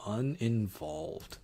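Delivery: Uninvolved said slowly, pondering; musing over which word fits, said slowly; slow, drawn-out pick. Real, not acted. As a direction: stretch the word Uninvolved out slowly as you settle on it. Repeats the chosen quiz word.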